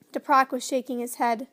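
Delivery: usual speaking voice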